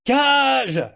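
high, loud bellow of a name; bawling. A narrator's reading. Ship's officer summons a sailor, bawling. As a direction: bawl it out to summon a crewman. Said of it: "il se mit à brailler".

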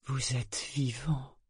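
chuchoté lentement, avec crainte respectueuse; murmure émerveillé. A narrator's reading. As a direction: souffler les mots, ébahi. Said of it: "murmura-t-il, empli d'une crainte respectueuse". (murmure plein de respect)

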